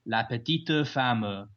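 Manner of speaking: la petite femme said as la petito famə, slow, with a sung extra E on each word; southern French lilt, add an E to the ends of petite and femme; Toulouse French accent. Shown as a demonstration. Demonstrating the Toulouse accent to the host, slow Toulouse French accent. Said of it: "they add an extra E at the end".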